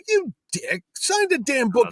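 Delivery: high-pitched, exasperated demand; retold arguing. Organizer demanding the artist's autograph, acted exasperated arguing. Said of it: "we start fighting about it".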